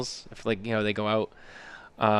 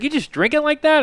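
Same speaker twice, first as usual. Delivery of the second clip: high-pitched, amazed question; imitating incredulous dad; 14 semitones higher, 4 dB louder than usual. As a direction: ask in mock disbelief, voice high. Dad amazed at straight whiskey.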